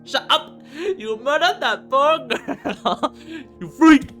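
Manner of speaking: high, very loud, drawn-out yell; enraged scream. Acted. Robin to murderer Yuri, screaming rage. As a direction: explode into screamed accusation.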